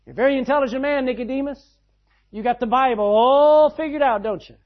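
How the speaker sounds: Jesus teasing the learned Nicodemus, loud and fast. Retold or imitated. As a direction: needle him with a quick grin in the voice.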